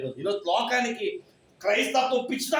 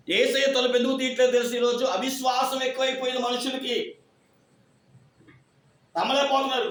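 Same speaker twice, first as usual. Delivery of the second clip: raised, forceful sermon voice; fiery preaching; 4 semitones higher than usual. Acted. Pastor scolding the congregation for unbelief, forceful. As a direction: drive the rebuke home to the church.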